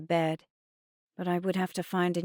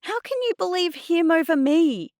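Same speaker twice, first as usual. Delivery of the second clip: high, loud wounded outrage; indignant protest; 12 semitones higher, 7 dB louder than usual. A narrator's reading. Girl to Mum who believes Adnan, indignant. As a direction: wail it in disbelief.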